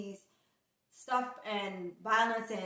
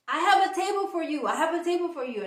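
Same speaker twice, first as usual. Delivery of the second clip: eager; 6 semitones higher, 5 dB louder, faster than usual. Retold or imitated. Host calls her to table.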